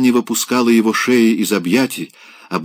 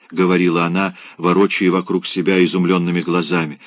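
ordinary delivery, nothing marked

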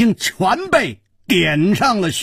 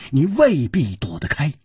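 ordinary delivery

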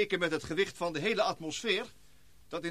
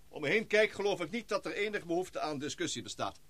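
ordinary talk

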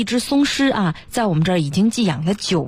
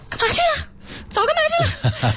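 very high-pitched mock indignation; retelling an angry outburst. Pet school worker retelling their anger at the long-absent owner, worked up and high-pitched. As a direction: squeal the reproach in imitation of an upset worker. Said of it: "自己很生气".

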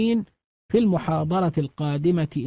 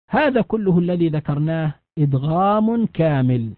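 ordinary delivery

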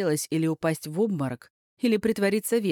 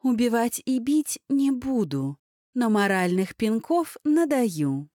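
slow, higher, snakelike hiss; hissing like a snake; 5 semitones higher, slower than usual. Acted. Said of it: "зашипела, как змея".

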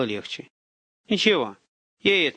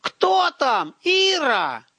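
desperate yelling; 12 semitones higher, 4 dB louder, much slower than usual. A narrator's reading. Prisoner yelling from apartment.

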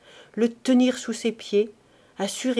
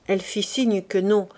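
usual speaking voice

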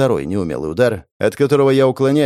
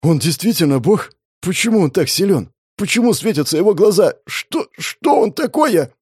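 questions climbing higher and higher; mounting panic; 7 semitones higher than usual. Acted. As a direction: spiral into frantic, stammering terror. Victim's mind races in terror.

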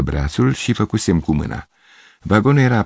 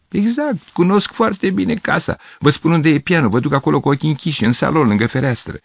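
much higher, eager and insistent; eager insistence; 9 semitones higher than usual. Acted. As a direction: insist he knows the house by heart. Gavrilescu insists, eager and certain. (Gavrilescu insistă, mult mai sus, cu însuflețire)

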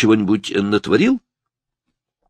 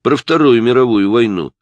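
louder, higher scornful tag; raised-voice dismissive scorn; contemptuous; 5 semitones higher, 7 dB louder than usual. A narrator's reading. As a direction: toss out the topic with disdain. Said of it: "заметил он с презрительной гримасой".

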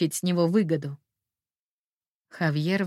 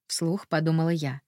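plain reading voice

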